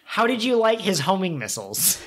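ordinary talk

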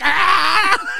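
very high, loud yell; mock scream. Comic acting out Bobby Blood Pressure, mock scream. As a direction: scream like an overheated hothead. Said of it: "he's just like".

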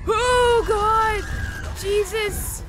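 frightened yelps. Real, not acted. Jump scare exclamations.

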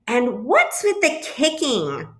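high, indignant demand; indignant question. A narrator's reading. Goose confronts the bluebird, indignant question. Goose scolds the kicking bird.

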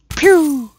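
high, sharp pew like a laser shot; mouth sound pew. Shown as a demonstration. Vlogger voicing his bow shot as a laser pew. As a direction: pop out a cartoon laser pew. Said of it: "this isn't a pew".

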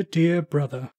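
usual speaking voice

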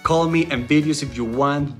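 normal talking voice

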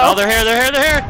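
high, loud panicked warning; panicked alarm. Real, not acted. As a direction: shout that the enemy is everywhere. Enemies suddenly all around him.

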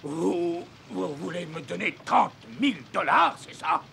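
high, loud disbelief; astonished. Acted. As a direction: shout in stunned surprise. Captain stunned by the offer.

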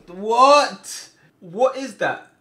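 loud, high exclamation of dismay; appalled disbelief. Real, not acted. Player sees his defender beaten, loud appalled cry. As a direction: shout it in indignant disbelief.

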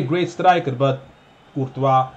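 ordinary talk

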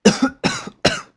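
loud, hammed-up hacking; fake coughs. Player pretending the room is poisoned, loud fake coughs. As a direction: cough loudly and obviously on purpose. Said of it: "Okay, now they're fake coughs".

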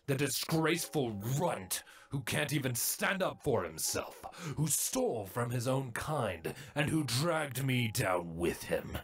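sneering, contemptuous drone voice; scornful older brother. A narrator's reading. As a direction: scoff down at him with disdain. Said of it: "Pharynx scoffed over him".

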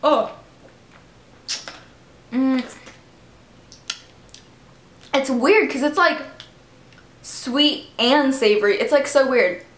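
talking while chewing; chewing candy. Real, not acted. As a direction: mouth partly full. Seen 1: Bites red candy at lips, then chews and grimaces while talking.

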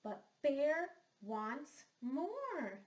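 slow, drawn-out storybook line; slow read-aloud; dramatic storybook line. Acted. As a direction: stretch it out for the children.

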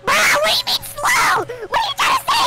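high indignant yell; indignant shout. Real, not acted. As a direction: shout back in disbelief. Reacts to friend's mockery.

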